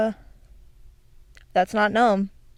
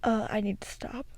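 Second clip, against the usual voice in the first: soft unvoiced whisper; 9 dB quieter than usual. Real, not acted. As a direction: whisper it to yourself. Whispered aside after laughing.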